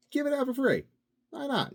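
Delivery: very high, fast, sing-song giving it away; bright high mock-generous pitch; mock chipper. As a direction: chirp it out like a cheery giver.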